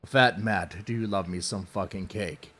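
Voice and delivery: normal talking voice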